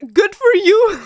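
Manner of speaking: high, loud, hammed-up tearful laugh; mock happy crying. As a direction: pretend to well up for someone else's joy. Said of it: "I'll start crying".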